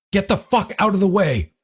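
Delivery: high, angry yell; shouting. Acted. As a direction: bark it across the room. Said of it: "I could hear Nichols shouting".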